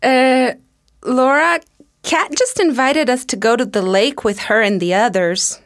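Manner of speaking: hesitant, wary of Laura; mildly nervous. Acted. Courtney nervously telling Laura about the invite, mildly uneasy. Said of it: "said Courtney nervously".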